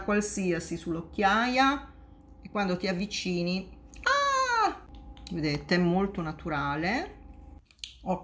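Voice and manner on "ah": sudden high startled ah; mock shriek of shock. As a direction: jump back in fake fright. Close look at bad concealer. (urlo di spavento finto)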